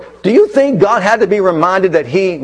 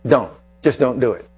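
ordinary talk, nothing marked

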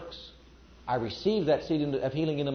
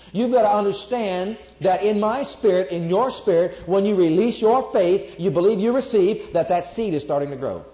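louder preacher's build, driving each phrase; full-force preaching; 3 semitones higher, 6 dB louder than usual. Acted. Preacher to congregation, full-force preaching.

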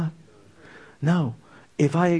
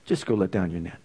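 softer and lower, calmly insistent; gentle quiet command; 8 semitones lower, 5 dB quieter than usual. Retold or imitated. Jesus telling Peter softly, calm and sure.

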